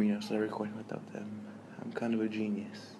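usual speaking voice

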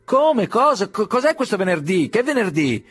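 high, strained pretend-deaf asking; feigned deafness. Dignitary pretending not to hear, high and strained. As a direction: act as if you can't hear.

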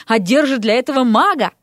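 plain reading voice

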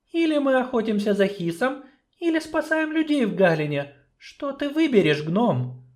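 high, measured female voice; doubtful sorceress. Acted. Sorceress doubting the dwarf's plan, high and measured.

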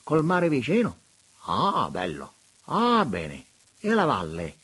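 high, loud, gushing bishop's voice; high delighted exclamations of a fawning prelate. A narrator's reading. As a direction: coo over the view like a delighted old monsignor. Monsignore voiced in story.

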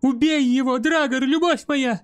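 high, urgent woman's cry. A narrator's reading. Girl urging her lover to kill the sorcerer, crying out. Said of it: "вскричала Десселин".

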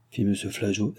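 usual speaking voice

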